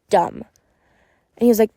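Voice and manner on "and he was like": normal talking voice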